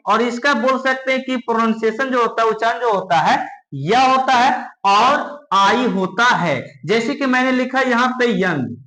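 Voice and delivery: ordinary talk, nothing marked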